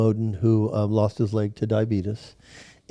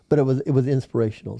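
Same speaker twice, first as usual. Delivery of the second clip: ordinary delivery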